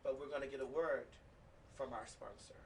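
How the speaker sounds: low, soft, subdued announcement; serious. Real, not acted. Host warning of a serious story after the break, subdued. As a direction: drop the voice and turn sober. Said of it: "get a little bit serious".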